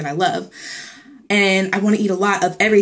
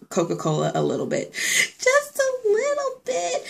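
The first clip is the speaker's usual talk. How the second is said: mock whiny confession; 5 dB quieter, slower than usual. Confessing Coke craving sheepishly.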